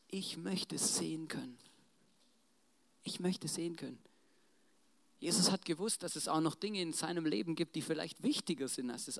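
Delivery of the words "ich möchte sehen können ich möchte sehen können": quiet plea. Acted. Blind beggar answers Jesus, quiet plea. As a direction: say it softly and simply, as a heartfelt request.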